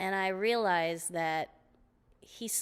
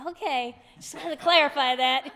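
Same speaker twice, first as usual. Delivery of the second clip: high, sweetly innocent; sheepish; 7 semitones higher, 7 dB louder than usual. Retold or imitated. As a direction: play it innocent and pleased. Sheepish reply after he concedes.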